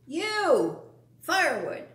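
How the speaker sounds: loud bossy bark; barked order. Acted. Vermin bossing the bunny around, loud bark. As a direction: snap the order at him.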